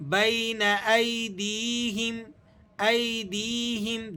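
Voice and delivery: ایدیہم with the ya drawn long; slow stretched ایدیہم. Shown as a demonstration. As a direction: lengthen the dee of ایدیہم. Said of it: "اس کو آپ نے کھینچ کر پڑھنا ہے".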